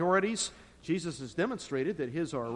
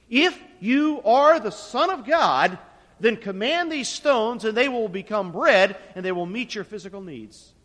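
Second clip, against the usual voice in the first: taunting Satan; 4 semitones higher, 10 dB louder than usual. Retold or imitated. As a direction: sneer it out as the tempter. Satan tempts Jesus to make bread.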